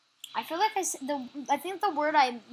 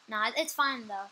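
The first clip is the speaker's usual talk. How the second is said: ordinary delivery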